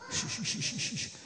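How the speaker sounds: unvoiced shh to quiet the room; soft repeated shushing. Real, not acted. Teacher quieting the listening children: soft shushing.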